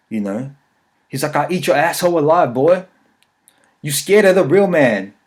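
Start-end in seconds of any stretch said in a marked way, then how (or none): 1.4-5.1 louder, higher mock tirade; Mike Tyson going off. Boxer goes off on man in the crowd, mock-angry tirade. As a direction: lightly imitate a boxer's angry outburst. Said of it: "Mike Tyson goes off on him".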